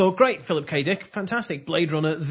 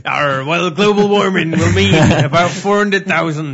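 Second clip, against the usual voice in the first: loud, laughing West Country burr; West Country farmer accent; 8 dB louder than usual. Shown as a demonstration. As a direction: growl it out like a farmer. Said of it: "do it in a pirate voice".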